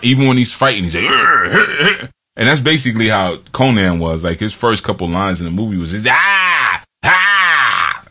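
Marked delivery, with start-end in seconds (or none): 1.0-2.1 high, hammed-up caveman grunts; imitating grunts. Telling a friend how Clubber Lang grunts, mock grunting. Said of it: "He's just grunt". 6.1-8.0 high mock brute grunts; imitating grunts. Describing Conan's first lines in the movie, mock grunting. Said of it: "That's the only sound he made".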